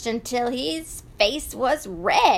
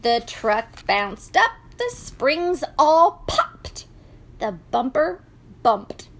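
ordinary delivery, nothing marked

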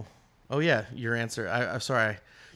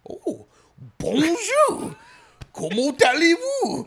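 much higher, louder, slow overdone French; mocking French practice; 9 dB louder, much slower than usual. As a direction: ham up a friend's stilted French lessons. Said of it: "did you say it like you were doing".

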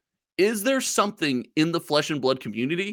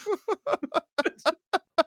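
high laugh. Real, not acted. Laughing after mock cast.

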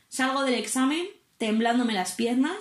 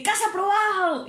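high, bright burst of good news; excited announcement; 11 semitones higher than usual. Retold or imitated. Instructor telling the student she passed, high excited cry. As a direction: announce the pass like a surprise.

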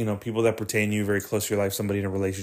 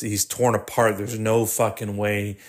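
ordinary talk

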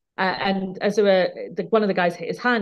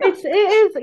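words spilling out mid-laugh, higher and louder; laughing through words; 12 semitones higher, 7 dB louder, slower than usual. Real, not acted. Starting her answer, still laughing.

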